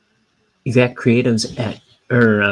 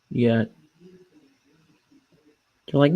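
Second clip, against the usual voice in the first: usual speaking voice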